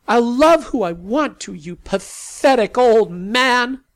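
high, loud, slow defiant shout; defiant yell. Acted. Sally as a girl defying her strict father, loud yell. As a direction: hurl it at him.

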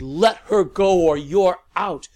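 full-throated warning roar; bellowing. A narrator's reading. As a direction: bellow it across the room. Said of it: "Lavinia bellowed".

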